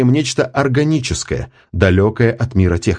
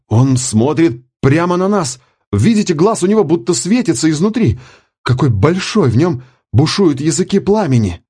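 awestruck; 7 semitones higher than usual. A narrator's reading. Commander gasps at alien eye.

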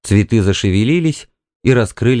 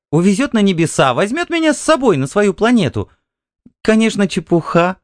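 high, light voice of a wife explaining herself; wife's voice, defensive; 10 semitones higher than usual. A narrator's reading. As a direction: pitch it well up, like a wife making excuses. (высокий женский голос, оправдываясь)

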